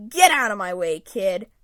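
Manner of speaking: put-on growled order from a grumpy old man; snarling. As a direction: snap at the kid like an old grouch. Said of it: "he snarls".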